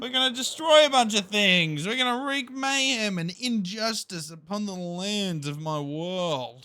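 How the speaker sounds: high-pitched, hammed-up announcer intro; over-the-top grandiose build-up; mock-grand proclamation.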